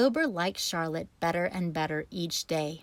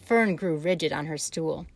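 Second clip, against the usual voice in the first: usual speaking voice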